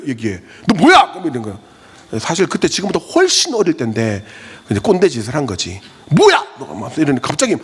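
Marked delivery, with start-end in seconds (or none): 0.7-1.1 high, loud sharp scolding of a student; loud stern rebuke. Retold or imitated. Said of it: "빡 얘기한 거죠". 6.1-6.5 high, loud defiant snap back; snapping teen. Retold or imitated.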